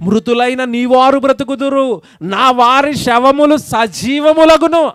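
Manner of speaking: preacher proclaiming scripture slowly; solemn scripture reading. Acted. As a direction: stretch every word of the verse out. Reads the verse aloud.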